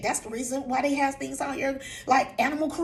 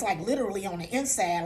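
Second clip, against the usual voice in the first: ordinary talk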